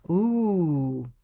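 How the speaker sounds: ordinary talk, nothing marked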